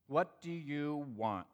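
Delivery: slow, measured asking; retold question, each word given space; deliberate question. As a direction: ask slowly and deliberately. Recap of his calm, listening question.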